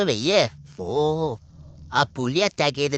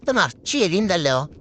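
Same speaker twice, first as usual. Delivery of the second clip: ordinary talk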